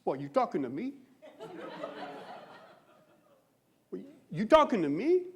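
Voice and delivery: lightly done Taxi Driver tough guy; Robert De Niro. Acted. Comic acting out De Niro's mirror scene, mock challenge. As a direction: challenge your own reflection, voice swinging up on the question. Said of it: "scene where he's talking to himself in the mirror".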